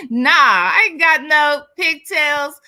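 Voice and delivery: "pigtails" said loud and slow, as a mock comeback; a playful, slow, loud denial. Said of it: "tell it figuratively".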